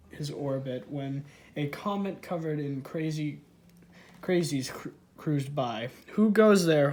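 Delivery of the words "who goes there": loud, raised challenge; read-aloud shout, higher and much louder; hollering. A narrator's reading. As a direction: holler it out like a lookout. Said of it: "hollered Skippy".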